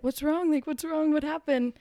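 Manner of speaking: high, anxious mock questioning; alarmed mom. Mother alarmed by crying daughter.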